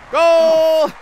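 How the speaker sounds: loud, high drawn-out cheer; excited goal call. Real, not acted. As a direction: shout it like a goal celebration. Calls out a goal in game.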